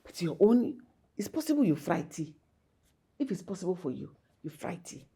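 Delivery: usual speaking voice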